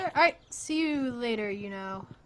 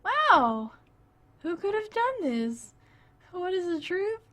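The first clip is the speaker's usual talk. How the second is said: high, hammed-up gasp of surprise; over-the-top amazed voice; mock shock; 9 semitones higher, 5 dB louder than usual. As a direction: play the shocked detective. Mock dramatic mystery reaction.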